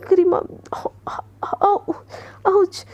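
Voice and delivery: high, pained ouch after a fall. Acted. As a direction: yelp the ouch as you hit the floor. Fell again under Garima.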